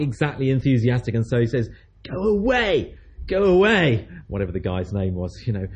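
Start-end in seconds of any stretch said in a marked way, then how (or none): 2.1-4.1 mock angry shout. Woken neighbour refuses at door.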